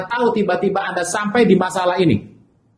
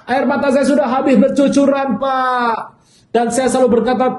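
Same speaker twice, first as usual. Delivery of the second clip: a hint of a weepy church member, higher and raised; lamenting congregant; 6 semitones higher than usual. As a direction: pour out the complaint like a tired, grieving woman. Troubled congregant complains to pastor. (jemaat mengeluh kepada pendeta, suara tinggi agak berseru)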